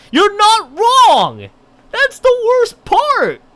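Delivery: high, loud, slow emphatic outburst; excited agreement. Acted. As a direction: belt it out in fired-up agreement. Character agrees loudly.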